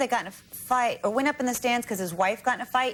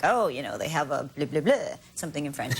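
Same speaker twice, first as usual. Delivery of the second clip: low, mumbled mock French wine order; lightly voiced fiancé reading the wine list; imitating Tony; 8 semitones lower than usual. Retelling fiancé ordering wine, low mock voice. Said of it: "he's like".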